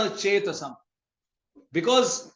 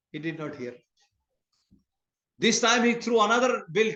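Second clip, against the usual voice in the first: ordinary delivery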